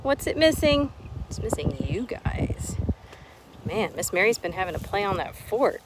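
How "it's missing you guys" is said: put-on soft, slow whisper to the kids. Teacher whispering to her absent class, softly. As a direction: lean in and whisper it, slower.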